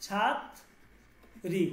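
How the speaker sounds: ছাত্রী very slowly, broken as chhat, pause, ri; split-syllable pronunciation. Shown as a demonstration. Teacher sounding out the word for learners, ছাত্রী slowly split. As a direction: split ছাত্রী in two with a long gap. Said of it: "কি?".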